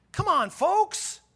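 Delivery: high, loud, drawn-out exasperated appeal; Jesus. Acted. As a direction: plead with your parents in mock exasperation. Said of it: "Jesus said to Mary and Joseph, he said".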